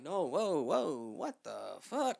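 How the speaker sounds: loud, drawn-out, wobbling whoas; retold freak-out, slow and high; alarmed disbelief. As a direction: stretch each word as if being lifted away. Feels body lifted into sky.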